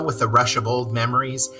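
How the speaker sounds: usual speaking voice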